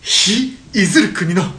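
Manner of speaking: grand slogan delivery. Acted.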